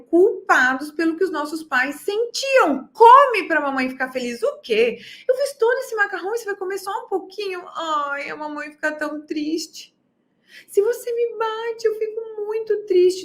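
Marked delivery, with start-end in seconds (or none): 2.9-13.2 higher, pleading mother voice; guilt-tripping mom. Retold or imitated. Mother guilt-trips her child over food, higher and pleading. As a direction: mimic a mother who makes the child feel guilty.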